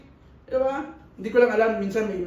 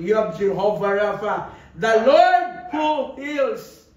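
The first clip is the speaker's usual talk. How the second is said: slow, stretched and louder; solemn declaration; 7 dB louder, slower than usual. Acted. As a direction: declare it like a revelation. Declaring a name of God.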